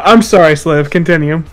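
ordinary delivery

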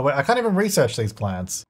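ordinary talk, nothing marked

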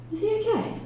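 high, softly voiced worry; worried. A narrator's reading. Sister asking police about her brother, high and worried. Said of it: "She looked worried".

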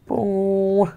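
playful held "boom"; imitated boom noise. Real, not acted. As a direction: make a sustained booming sound effect. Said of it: "now you can hear some noises".

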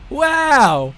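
high, loud exclamation; amazed shout. Real, not acted. Player amazed at the play, amazed shout.

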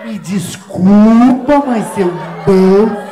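very slow, loud, word by word; slow exaggerated speech. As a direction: spell it out as if to a fool. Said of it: "mock slow speech".

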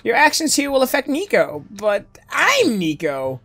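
lightly voiced, puzzled game hero; puzzled Nico.

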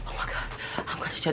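soft, hushed mock dread; scared whisper. Patient on the moving bed fearing jail, soft scared whisper. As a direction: whisper it fretfully under the breath. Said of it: "getting even more scared".

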